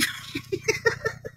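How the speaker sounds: airy laugh; scoffing breathy laugh. Real, not acted. Laughing at the threat.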